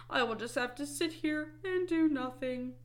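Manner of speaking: high, whiny Toad; mock despair. A narrator's reading. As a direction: moan it in storybook misery. Toad despairing over lost list.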